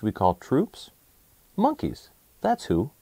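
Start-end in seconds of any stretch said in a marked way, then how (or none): 1.6-2.9 bright high reveal of the answer; spring the answer on the listener; cheerful big reveal, pitched up high; excited reveal. A narrator's reading. Answer to the riddle.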